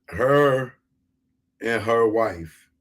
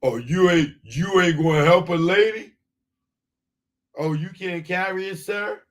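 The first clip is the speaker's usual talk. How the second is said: mock woman; 6 semitones higher than usual. Mocking woman asking for help.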